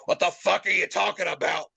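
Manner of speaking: imitating crude Bull. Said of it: "as eloquent as he could possibly be".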